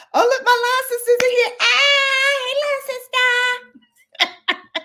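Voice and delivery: high, loud, fast happy squeal; light up as your line sister walks in; delighted high-pitched hello; excited greeting. Real, not acted. Friend joins the live stream.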